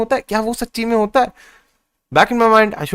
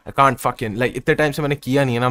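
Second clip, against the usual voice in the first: ordinary talk, nothing marked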